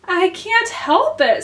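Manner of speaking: high, slow meek little reply. A narrator's reading. Alice excusing herself to the Dormouse, very meekly. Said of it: "said Alice very meekly".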